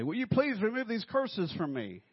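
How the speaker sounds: high, loud desperate plea; mock pleading scammer. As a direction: beg to lift the curse. Imagined scammer begging.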